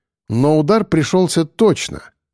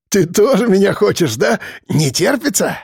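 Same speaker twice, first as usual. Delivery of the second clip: husky, higher-pitched goading voice; hoarse leering; 5 semitones higher than usual. A narrator's reading. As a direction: leer at her in a hoarse voice. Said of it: "хрипло хохоча".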